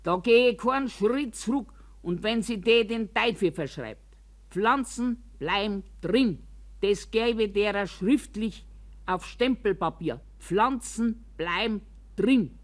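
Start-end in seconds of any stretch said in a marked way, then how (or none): 4.5-6.4 slow, each word stressed separately; emphatic insistence. Acted. Resident refusing to give up plants, emphatic insistence. 10.4-12.6 slow, each word stressed separately; emphatic insistence. Acted. Resident refusing to give up plants, emphatic insistence.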